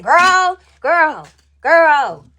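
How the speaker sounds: loud, drawn-out repeated exclamation; each one a fresh wave of shock; scandalized disbelief. Retold or imitated. Retelling shocked reaction in church.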